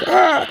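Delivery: very high-pitched imitated creature cry; sudden high mock screech; dinosaur screech. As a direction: let out a short, high dinosaur squawk. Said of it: "his dinosaur comes out and he's like".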